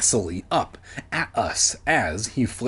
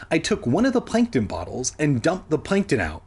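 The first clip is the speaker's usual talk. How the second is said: plain reading voice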